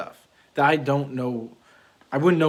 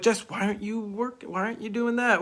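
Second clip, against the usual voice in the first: higher-pitched, mildly accusing questioning; accusing nag; 8 semitones higher than usual. Pretend. Husband mock-nagging his wife about chores, accusing whine. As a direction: pretend to nag your partner with a whiny edge. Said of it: "I don't look to Jess like".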